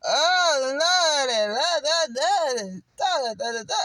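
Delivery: loud, high, wailing fake prayer. Mocking showy churchgoers, a loud, high wailing prayer. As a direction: ham up a showy church wail, crying out to the Lord. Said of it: "like you so holier than thou".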